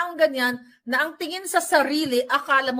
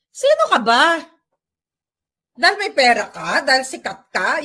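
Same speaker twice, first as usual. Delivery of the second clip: angry challenge; 6 dB louder than usual. Real, not acted. Ranting at the arrogant celebrity.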